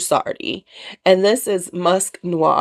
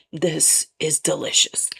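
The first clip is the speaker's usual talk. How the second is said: whisper; 3 semitones lower, 5 dB quieter than usual. Real, not acted. Smelling a perfume she loves.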